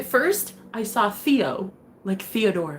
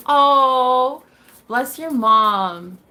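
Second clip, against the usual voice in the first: very slow, louder and tender; drawn-out gushing blessing; 5 semitones higher, 7 dB louder, much slower than usual. Real, not acted. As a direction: bless her mom lovingly. Gushing at viewer's mom.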